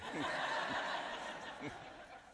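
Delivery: real breath-filled laugh, trailing off. Real, not acted. Interviewee recalling rides with singers, real amused laugh. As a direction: laugh airily at the memory.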